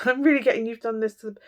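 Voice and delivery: normal talking voice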